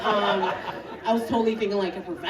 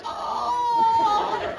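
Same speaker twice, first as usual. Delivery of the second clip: mock old man groan; 13 semitones higher than usual. That's what an old dude sounds like.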